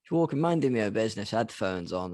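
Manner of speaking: normal talking voice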